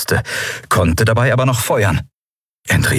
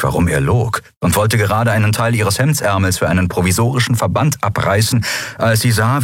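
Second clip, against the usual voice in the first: usual speaking voice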